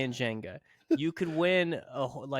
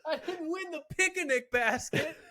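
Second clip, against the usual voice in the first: high, drawn-out hammed-up wail of frustration; Smokey Bear; 12 semitones higher, slower than usual. Comic sore loser missing out on the picnic basket, mock fury. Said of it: "Smokey Bear was in the back".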